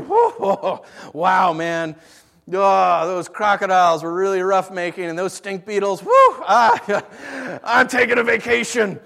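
loud, puffing, hammed-up weariness; mock exhausted God. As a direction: huff like a worn-out workman, laughing. God as exhausted worker, mocked.

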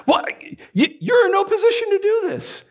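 high, disbelieving protest; can't believe the nerve; high incredulous outburst. Retold or imitated. Incredulous outburst at picky beggar.

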